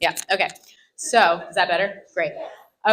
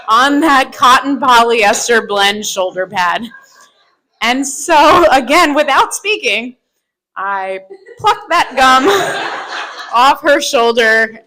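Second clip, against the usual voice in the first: laughing; 4 semitones higher, 6 dB louder, slower than usual. Real, not acted. Laughing at the memory.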